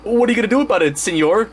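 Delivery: high-pitched sidekick voice; put-on high voice, rising at the end; questioning cameraman. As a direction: ask it in a high cartoon voice. Cameraman José challenges the star.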